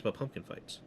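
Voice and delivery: whispered. Real, not acted. Whispered correction aside.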